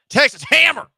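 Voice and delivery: loud, high ad-announcer bellow; big mock commercial shout; hammed-up ad shout. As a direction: yell it like a late-night TV lawyer ad. Shouting an ad slogan.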